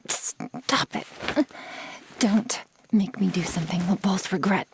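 ordinary delivery

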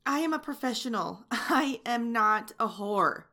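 loud, furious telling-off that keeps building; furious rant. Acted. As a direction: let the outrage boil over at him. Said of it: "You've made me so mad".

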